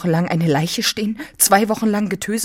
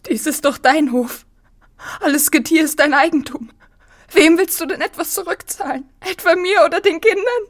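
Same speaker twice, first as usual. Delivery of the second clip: climbing higher and higher until crying; rising to tears; 12 semitones higher, 3 dB louder than usual. Acted. Anna pleading with Manao, rising to tears. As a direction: let the pitch climb until it breaks into crying. Anna's mounting distress.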